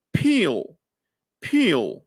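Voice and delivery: peel with pressed lips and a puff of air after the p; aspirated p. Shown as a demonstration. Teacher modelling the aspirated p. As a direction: squeeze the lips, then release peel with breath. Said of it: "al mismo tiempo que saco la P".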